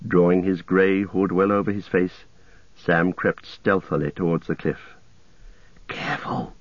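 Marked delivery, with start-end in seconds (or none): 5.9-6.5 soft hushed caution, much softer; whispered warning. A narrator's reading. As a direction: hush him before he is heard. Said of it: "whispered Frodo".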